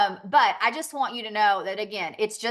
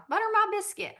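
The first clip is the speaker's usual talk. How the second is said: high-pitched Southern imitation; playful Southern impression; mock Southern; 11 semitones higher, faster than usual. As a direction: lilt it high in a put-on Southern voice. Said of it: "like, Southern".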